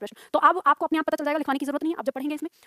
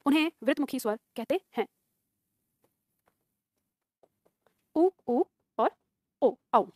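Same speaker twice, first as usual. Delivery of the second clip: measured phrases with long gaps, growing softer; dictation; 2 semitones lower, 3 dB quieter than usual. Acted. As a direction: dictate unhurriedly for students writing it down. Teacher dictates a definition. (धीरे-धीरे श्रुतलेख बोलते हुए)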